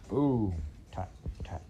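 a hushed boom, tat, tat; whispered drum. A narrator's reading. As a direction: echo of the drum from far off. Drum sound fading.

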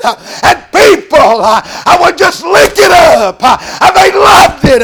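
rising to high, loud preaching shouts; old-time preaching at full cry; full-force preaching. Acted. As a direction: build the sermon to a roar.

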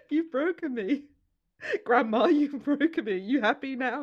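loud, high mock despair at the heckler; mock wounded comic. Comedian pleads with the heckling gran, hammed-up despair. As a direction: ham up being crushed by a granny.

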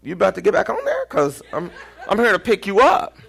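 fast, high, laughing tease; laughing driver. Retold or imitated. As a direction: driver laughing at your panic. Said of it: "He goes".

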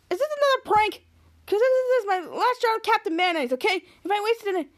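high-pitched fretting; mock alarm. Captain Man frets about a prank.

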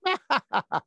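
high cackling witch laugh; scary witch laugh. Acted. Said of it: "That was my scary witch laugh".

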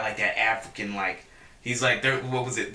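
ordinary talk, nothing marked

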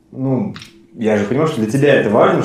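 ordinary talk

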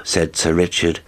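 ordinary delivery

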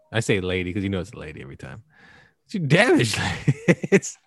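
ordinary talk

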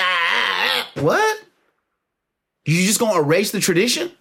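mock disbelief. Mocking reaction to dropped tradition.

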